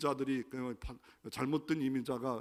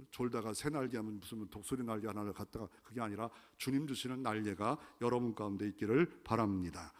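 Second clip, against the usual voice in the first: ordinary talk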